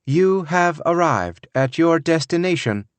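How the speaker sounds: robotic GPS voice; car computer voice. A narrator's reading. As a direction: read it like a navigation system. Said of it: "the computer said".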